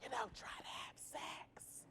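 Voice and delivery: slow, very soft whisper; confiding whisper. Real, not acted. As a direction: whisper it like a scandalous secret. Confides a taboo detail.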